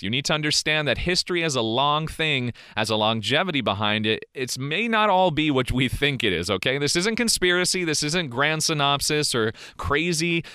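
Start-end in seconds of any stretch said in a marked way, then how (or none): none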